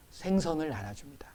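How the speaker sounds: whispered ending of the breakfast scene; hushed. Real, not acted. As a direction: let the words fall to a whisper. Hushed close of Jesus scene.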